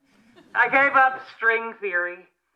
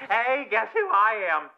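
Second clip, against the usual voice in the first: ordinary talk, nothing marked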